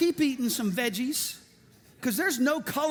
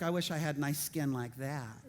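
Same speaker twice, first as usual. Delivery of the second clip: inner voice musing; 6 semitones lower, 6 dB quieter than usual. Retold or imitated. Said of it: "nobody really talks like that".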